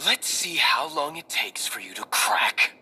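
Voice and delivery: slow, threatening whisper; menacing whisper. Acted. Interrogator menaces bound captive.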